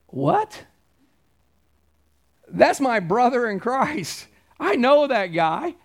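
high sharp "What?" then slow insistence; shocked protest. Retold or imitated. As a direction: voice the shock of a young pastor. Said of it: "I was like".

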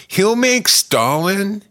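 ordinary talk

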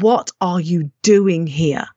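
slow, low imitated challenge; retold confrontation. Inner voice confronting her on the balcony, retold confrontation. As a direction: voice an inner attacker, drawing out each word. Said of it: "someone punched me in the stomach".

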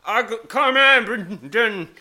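slow, high, loud slurred call; imitating drunk man. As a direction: drag out the drunk words. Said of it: "this guy goes".

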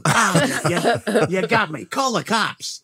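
high-pitched mock TV American accent. British speaker recalling childhood cops and robbers, in a hammed-up American accent. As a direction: play-act a TV shootout in American. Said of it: "do it with American accents".

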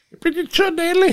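imitating the big woman guard high-pitched; Gianelli's voice. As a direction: mimic her introducing herself. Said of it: "hat sie gesagt".

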